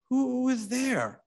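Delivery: high, slow frightened call from inside; a scared woman calling through the door. A narrator's reading. Sick woman at her window, frightened. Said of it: "asks a frightened voice".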